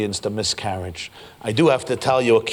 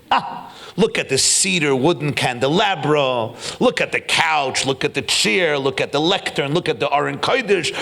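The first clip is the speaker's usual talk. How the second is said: proud showing off; 3 semitones higher than usual. Acted. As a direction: present each piece grandly, with rising excitement. Carpenter showing off his work to God.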